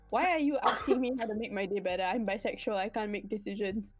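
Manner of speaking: normal talking voice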